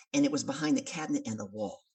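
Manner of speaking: ordinary talk, nothing marked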